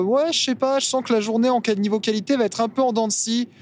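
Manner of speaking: high mocking voice quoting a friend; mocking quote. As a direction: squeak it out like a whiny friend. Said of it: "citer quelqu'un ce matin qui a dit".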